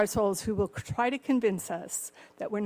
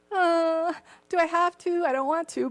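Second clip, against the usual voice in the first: higher, louder whining; preacher voicing his own reluctance, higher and whiny; childish whine; 8 semitones higher, 5 dB louder than usual. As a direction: whimper like a kid who won't go. Whiny reluctance, self as kid.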